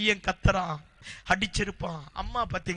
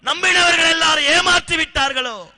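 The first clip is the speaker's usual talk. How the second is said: loud, higher, building preacher's cry; fervent preaching; 6 semitones higher, 7 dB louder than usual. Acted. Preacher as God questions Hagar's despair, loud building cry. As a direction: preach it with rising fire.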